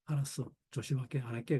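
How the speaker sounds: soft, low backing down of a cornered husband; imitated mumbled concession. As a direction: act out giving in under your breath. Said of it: "그러고 얼버무리고 말았죠". (얼버무리듯 작고 낮게)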